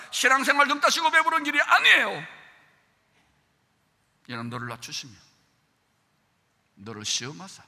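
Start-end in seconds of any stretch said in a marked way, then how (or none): 0.1-2.3 loud, high, emphatic preaching; preaching shout. Acted. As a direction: raise the voice to a climax. Preacher builds to full force.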